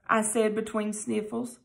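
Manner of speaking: usual speaking voice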